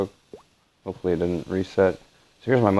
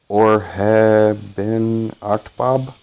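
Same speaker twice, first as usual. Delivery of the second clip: louder, drawn-out mock Russian gibberish; mock Russian accent; 2 semitones higher, 5 dB louder, much slower than usual. Shown as a demonstration. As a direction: make a slow, loud mockery of Russian. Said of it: "English Russian accent would sound something like".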